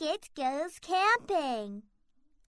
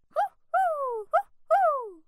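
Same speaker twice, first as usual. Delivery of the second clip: owl hooting; 10 semitones higher than usual. Acted. Wind sounds like owl.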